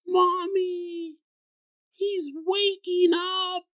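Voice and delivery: put-on very high, slow child's voice calling out in fright; a small child crying out to her mommy; scared little girl. Daughter warning her mother about the ghost, high scared child voice.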